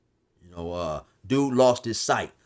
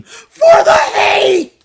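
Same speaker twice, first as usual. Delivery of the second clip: much higher, very slow loud scream; 14 dB louder, much slower than usual. Retold or imitated. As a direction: stretch out a taunting yell. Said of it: "Then he'd scream".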